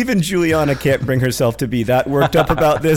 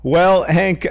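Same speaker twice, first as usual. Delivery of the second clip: normal talking voice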